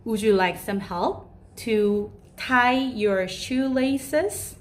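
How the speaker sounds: very slow, careful model question; slow polite offer. Shown as a demonstration. Teacher offering to tie a child's shoelaces, very slow. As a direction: offer help, one word at a time. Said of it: "그럴 때는".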